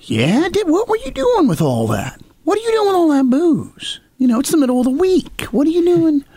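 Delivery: high, hammed-up father questioning his son; mock dad voice. Son retells how his father questioned him about the booze, in a high mock voice.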